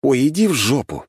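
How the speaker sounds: fed-up retort; exasperated sigh. A narrator's reading. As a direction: brush them off with exasperation. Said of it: "вздохнул я".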